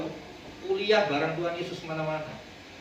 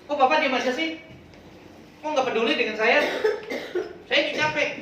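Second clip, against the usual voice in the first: higher, whining servant; whiny complaint; 6 semitones higher than usual. Retold or imitated. Maid whining at her boss, tired and annoyed. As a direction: complain to the boss, put upon. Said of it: "ngomongnya begitu".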